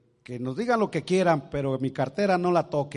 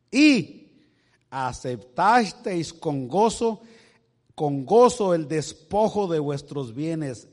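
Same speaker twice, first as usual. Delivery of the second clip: louder, slower solemn Bible reading; scripture reading; 3 semitones higher, 7 dB louder than usual. Acted. Pastor reading the verses to the congregation. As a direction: read the verse out with weight.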